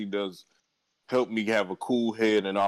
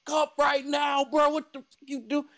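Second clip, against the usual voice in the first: much higher, shouted at the screen; yelling at the TV. Retold or imitated. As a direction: yell at the character to grab the cup. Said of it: "yelling, like".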